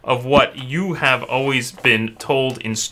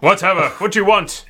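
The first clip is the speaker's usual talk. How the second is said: dismissive knight; 8 semitones higher than usual. Acted. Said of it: "he says".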